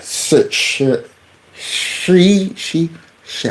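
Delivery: "she" tried in broken pieces; halting sounding out. Shown as a demonstration. Host attempting unfamiliar name "she", halting sounding out. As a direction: stumble from "Sh-" towards a drawn-out "she". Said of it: "how do you say that".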